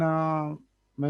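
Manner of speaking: ordinary talk, nothing marked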